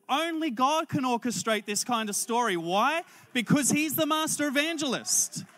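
high, loud declaration; impassioned preaching. Real, not acted. Preacher telling the congregation God orchestrated the story, impassioned. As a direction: drive the point home to the crowd, pitch high.

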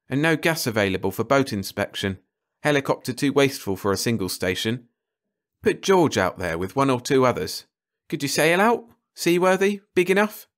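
expert voice rising into high questions; ecologist thinking aloud. Acted. Carter planning, then asking George about his boat, eager. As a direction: work out a plan, then quiz eagerly.

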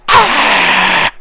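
loud human-like mock scream; sudden mock shriek of alarm; imitated deer scream. A deer spotting the hunter, mock scream. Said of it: "one of 'em sees you and they're like".

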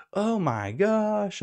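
slow, high, swooping gasp of dismay; mock shock. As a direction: draw it out high as the horrified friends. Friends react to cancer news.

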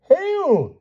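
very high-pitched cry; squealing. A narrator's reading. Sir Palomides hails the princess, squealing. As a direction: squeal a greeting. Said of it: "squealed Sir Palomides".

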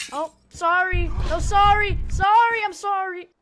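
loud, high, rushed sorry; panicked apology. Real, not acted. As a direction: blurt out the apologies.

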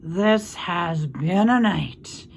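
slow, drowsy rat voice; drawn out, nodding off; sleepy rat. Acted.

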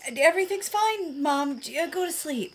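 high-pitched, stammering cover-up; flustered reassurance. Acted. Daughter sending Mom back to bed, flustered. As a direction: brush it off nervously, pitch up high. Caught out, hushing worried Mom.

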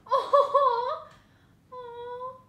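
very high, loud squeal of delight; overjoyed fan squeal at a cute card; squealing excitement. Real, not acted. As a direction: gush over her like a fan. Overjoyed at Isabel kimono card.

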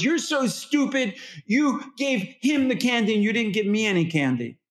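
put-on high, whining child complaining about candy; whiny toddler. Toddler whining at mom about the candy. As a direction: whine it like a cross little kid. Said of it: "when your child says".

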